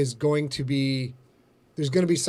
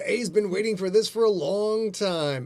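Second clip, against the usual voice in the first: ordinary delivery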